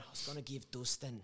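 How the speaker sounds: put-on Dublin Irish accent; Irish-accented trash talk; Conor McGregor. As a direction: do a cartoonish Irish fighter's brogue. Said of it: "include the Conor Mc-, the Conor McGregor".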